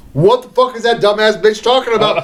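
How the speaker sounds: hammed-up indignant outburst; mock outrage. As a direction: rant incredulously, pushing the pitch up as it goes. Acted outraged defendant in a story.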